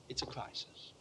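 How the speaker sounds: hushed, breathy whisper after the shouting; a stage whisper; sudden whisper. Real, not acted. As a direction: drop to a whisper to make them lean in. Hushed after loud build.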